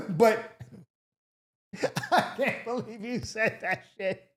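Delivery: real disbelieving laughter; laughing. Real, not acted. Cracks up at the letter.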